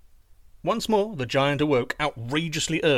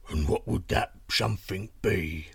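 low rumbling giant; 5 semitones lower than usual. Acted. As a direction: answer as a deep-voiced giant in bed. Giant replies to princess.

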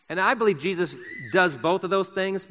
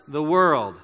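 usual speaking voice

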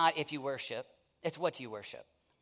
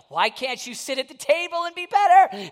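high, louder mock scolding wife; wife scolding husband watching football at the in-laws, higher and louder; nagging wife; 10 semitones higher, 12 dB louder than usual. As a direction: needle him like a fed-up wife. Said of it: "my wife is looking at me".

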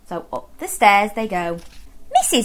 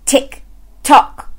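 clock ticking; 4 semitones higher, 4 dB louder than usual. Acted. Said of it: "went the living room clock".

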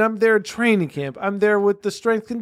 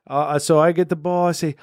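ordinary delivery

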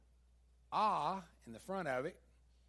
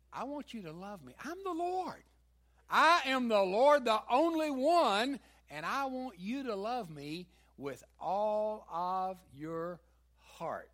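louder, higher, slow preaching as God; preaching God's voice; 6 semitones higher, 10 dB louder than usual. Acted. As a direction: proclaim it as the Lord, booming. Voicing God's call at full force.

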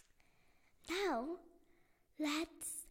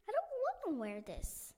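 whiny complaint; 8 semitones higher than usual. Acted. Luna complains about uniform.

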